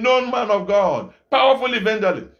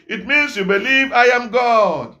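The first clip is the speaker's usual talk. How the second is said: usual speaking voice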